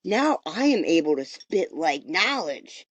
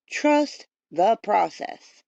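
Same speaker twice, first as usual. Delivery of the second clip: slow emphasis; 2 semitones higher, 3 dB louder, slower than usual. Shown as a demonstration. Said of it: "repeats slowly for emphasis".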